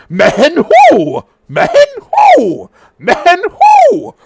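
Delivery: high, loud mocking taunt; berating Serpent King. Serpent King berating the sobbing dragonborn, high mocking voice. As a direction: sneer the name back at him like a bully king. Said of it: "The Serpent King is berating him".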